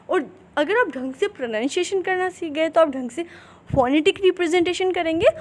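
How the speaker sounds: ordinary delivery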